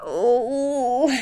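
long drawn-out pained groan. A narrator's reading. Injured elf holding his head, a groan. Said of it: "the elf groaned again".

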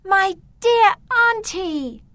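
put-on high, slow, joyful girl's greeting; happy. Beatrice runs to hug her aunt, high happy greeting. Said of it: "she said happily".

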